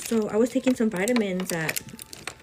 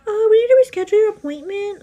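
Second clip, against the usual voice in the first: much higher, louder fake-sweet phone voice; mock receptionist; 14 semitones higher, 10 dB louder than usual. Imitating the clinic receptionist on the phone, chirpy high voice. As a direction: chirp it like an overly cheerful receptionist.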